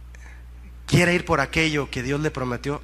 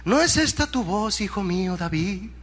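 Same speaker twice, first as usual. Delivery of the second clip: Saul calling up. Acted. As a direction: call up uncertainly to a son far off. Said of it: "lo escuchó gritar a David". (llamado de padre desde abajo)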